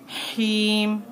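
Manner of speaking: हीम closed on the म with no ी after it; paused ending. Shown as a demonstration. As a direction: stop हीम dead on the म. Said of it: "रुकना है".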